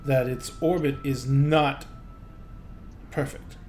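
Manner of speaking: a scholar explaining bad news gravely; grave gnome scholar. Gnome scholar explaining orbit decay to the visitors, soft and grave.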